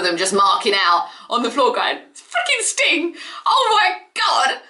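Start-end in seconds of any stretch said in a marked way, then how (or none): 2.1-4.6 excited. Retold or imitated. Wrestlers on the floor marking out over Sting, gushing. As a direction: gush like a starstruck wrestler.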